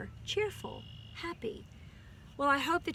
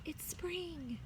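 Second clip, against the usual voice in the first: soft; 6 semitones higher, 9 dB quieter than usual. Acted. Quiet repeat closing line.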